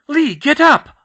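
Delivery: high, loud pleading cry; desperate scream. Acted. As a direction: beg him to rise. Desperate plea to friend.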